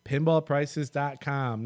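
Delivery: upbeat sponsor read voice. Acted. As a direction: read it like a radio ad. Reads sponsor list.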